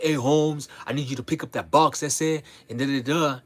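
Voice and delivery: hammed-up homeboy bossing. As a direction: parody the expected gangster talk.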